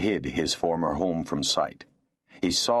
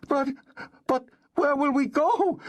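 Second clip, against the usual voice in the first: much higher, despairing plea; despairing cry. Acted. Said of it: "the woman cried despairingly".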